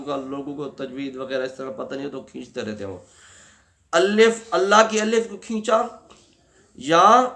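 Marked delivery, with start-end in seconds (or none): none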